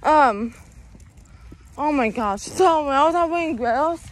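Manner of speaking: ordinary talk, nothing marked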